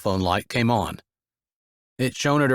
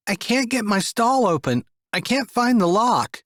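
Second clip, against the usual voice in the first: put-on high child voice; a kid who can't open the door; young boy stuck in a stall; little boy stuck; 11 semitones higher than usual. A narrator's reading.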